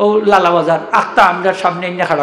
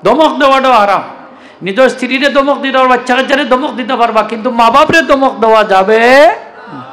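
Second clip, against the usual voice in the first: emphatic preaching; 4 semitones higher than usual. Acted. Preacher forbidding scolding parents, emphatic. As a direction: drive home the ban.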